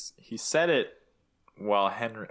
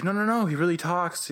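frantic husband; 8 semitones higher than usual. Retold or imitated. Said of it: "he's like".

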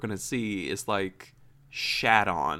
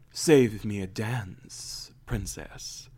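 slow, smooth flirty line; suave prince; 2 semitones lower, much slower than usual. Retold or imitated. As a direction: purr it like a cheesy charmer. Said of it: "finally he's like".

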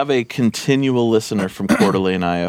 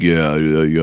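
lower, deep-voiced imitation of the actor; mock deep voice; 7 semitones lower, slower than usual. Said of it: "Super deep voice".

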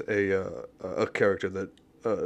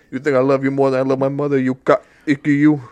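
mock Italian retort; 4 semitones higher, 8 dB louder than usual.

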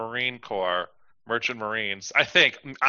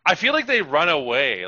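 normal talking voice

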